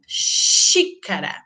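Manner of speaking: "xícara" slowly, XÍ drawn out; stressed first syllable. Shown as a demonstration. Teacher giving an acute accent example, stressed first syllable. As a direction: stretch the opening syllable of "xícara". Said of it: "forte, o aberto".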